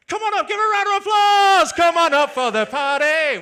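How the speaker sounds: big, high hype-man shout to the room; hyped-up shout. Real, not acted. Comedian summons an audience member on stage, hyped-up shout. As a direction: call them up loud and high.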